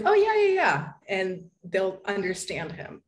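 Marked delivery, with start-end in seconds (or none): 0.0-0.9 high, loud eager "oh yeah yeah"; mock sudden understanding. Waiter suddenly understanding her partner's Spanish, high and eager. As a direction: play the waiter who suddenly gets it. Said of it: "And they'll be like".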